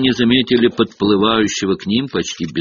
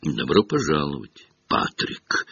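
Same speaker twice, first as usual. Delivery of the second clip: quiet, cool, held back; restrained; 5 semitones lower, 6 dB quieter than usual. A narrator's reading. Nick stiffly greeting Sir Patrick, quiet and cool. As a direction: greet him stiffly and coolly. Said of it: "сдержанно поприветствовал".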